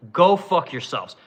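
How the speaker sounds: normal talking voice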